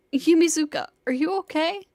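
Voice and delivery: loud worried call. Acted. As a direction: call her name in alarm. Calling to wounded attacker.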